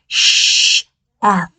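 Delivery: "Sh-F" as two hissed sounds; sounds split. Shown as a demonstration. Teacher breaks down the sounds of Chef. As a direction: separate "Sh" and "F" clearly. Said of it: "like this".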